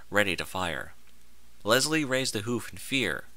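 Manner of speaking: ordinary delivery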